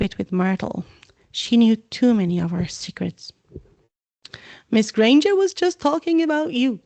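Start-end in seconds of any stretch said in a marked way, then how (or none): 4.7-6.8 high, sly tattling. Acted. Peeves telling on Hermione, sly. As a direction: whisper the gossip slyly. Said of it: "said Peeves slyly".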